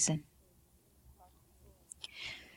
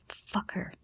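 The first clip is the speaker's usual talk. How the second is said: much softer whispered curse; bitter inner thought; 12 dB quieter than usual. A narrator's reading. Daughter cursing mother in her head, whispered. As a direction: think the curse silently. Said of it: "Celestine thought".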